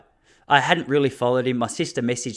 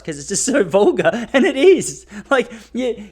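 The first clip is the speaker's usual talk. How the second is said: laughing emphasis; 8 semitones higher, 5 dB louder than usual. Real, not acted. Laughing about vulgar talk.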